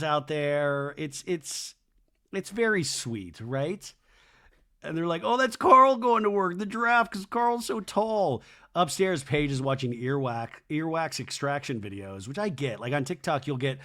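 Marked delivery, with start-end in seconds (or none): 5.2-8.4 high, loud opening, gleeful mock wonder; imitated delight. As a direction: gush like thrilled little boys at a cartoon. Guys delighted by Zootopia giraffe.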